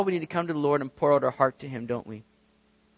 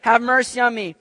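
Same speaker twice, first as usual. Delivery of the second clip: raised, high plea while reading; scripture read with a raised cry; 7 semitones higher, 7 dB louder than usual. A narrator's reading. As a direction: read the blind man's cry aloud, loud and high. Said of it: "began to cry out and say".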